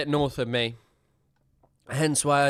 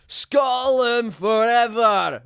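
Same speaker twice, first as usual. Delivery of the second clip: long, drawn-out rousing yell; battle cry; 7 semitones higher, 5 dB louder, much slower than usual. Pretend. Streamer reading out a viewer's Scotland chant, rousing drawn-out yell. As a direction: bellow it high and slow like a war cry.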